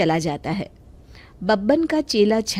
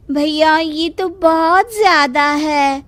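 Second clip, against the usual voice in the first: higher, slow, loud woman's protest; pleading village woman; 7 semitones higher, 5 dB louder, slower than usual. Acted. Woman protesting the repair price to the mechanic, slow and higher. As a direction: draw out the complaint like a shocked customer.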